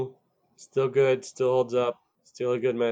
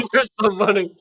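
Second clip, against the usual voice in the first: higher, louder, desperate shout; angry yell; 9 semitones higher, 7 dB louder than usual. Retold or imitated. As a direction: demand it in a rage. Repeats the yelled movie line.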